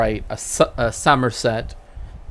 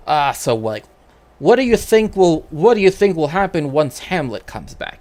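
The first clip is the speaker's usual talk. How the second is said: actor addressing audience; 5 semitones higher than usual. Retold or imitated. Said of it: "And then they're like".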